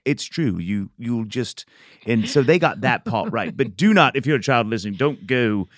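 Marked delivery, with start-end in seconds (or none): none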